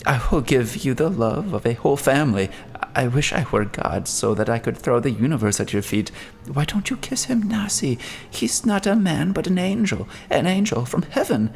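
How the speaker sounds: a father beside himself with devotion; frenzied. Acted. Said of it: "in a frenzied clasp".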